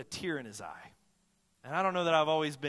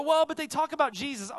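higher, louder objecting voice; high, raised protest from the congregation; imagined objector; 9 semitones higher, 6 dB louder than usual. Pretend. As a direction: voice a skeptical listener pushing back. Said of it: "And maybe you go".

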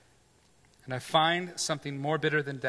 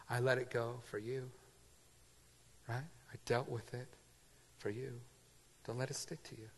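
ordinary talk, nothing marked

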